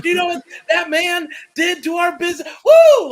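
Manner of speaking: angry promo ending in a woo; Ric Flair rant. Retold or imitated. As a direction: rant then woo like a wrestler. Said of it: "he couldn't even contain his woos".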